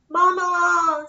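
high, loud call for Mama. Acted. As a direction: call out across the forest. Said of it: "Small Bear called".

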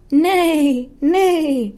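whinny like a horse. Acted. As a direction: horse neighs at librarian. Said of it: "All she heard was".

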